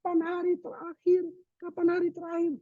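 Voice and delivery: very high mock voice of people asking; high-pitched parody of anxious askers; imitated questioners. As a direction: squeak it out like everyone pestering with one question. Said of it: "Biasanya semua pertanyaan".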